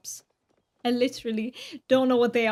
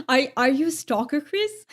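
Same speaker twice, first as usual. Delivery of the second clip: teasing; 3 semitones higher than usual. Real, not acted. Teasing a chatter about stalking.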